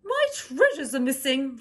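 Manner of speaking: high, loud distressed queen; frantic queen calling; upset cry. Acted. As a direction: cry out in alarm. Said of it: "she cried".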